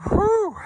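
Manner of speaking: high, loud relieved exhale; exhausted whew. Real, not acted. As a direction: let out a big breath. Exhales after long climb.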